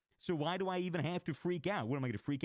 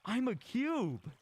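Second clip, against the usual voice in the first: much higher, slow, dazed insistence; stoned friend insisting; 9 semitones higher, 3 dB louder, slower than usual. Retold or imitated. Friend on shrooms telling his buddy he is a cube, high and dazed. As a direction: stretch it out, high and spaced out.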